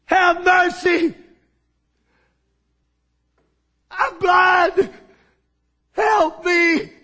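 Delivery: high, loud, desperate cry, slow on mercy. Acted. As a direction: shout for help across a crowd. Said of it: "He began to cry out".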